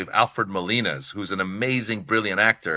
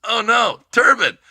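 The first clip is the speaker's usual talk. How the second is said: high-pitched, dismayed realisation; comic acted-out horror; mock dread; 12 semitones higher, 5 dB louder, slower than usual. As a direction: jump way up in pitch, hammed-up alarm. Retold horror at realising the request.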